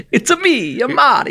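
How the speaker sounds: high, loud, laughing Italian plumber voice; hammed-up Mario, high and bright with a laugh; Mario impression. Mario impression after Italian joke.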